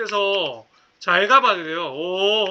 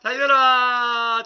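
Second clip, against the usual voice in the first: drawn-out urging; 5 dB louder, much slower than usual. Real, not acted. Urging the car on.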